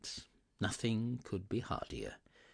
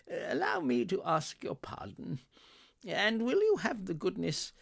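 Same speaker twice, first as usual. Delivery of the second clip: high-pitched, polite pleading; apologetic Scrooge; 11 semitones higher than usual. Acted. Scrooge asking the gentleman's pardon, apologetic. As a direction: ask humbly, pitched up high.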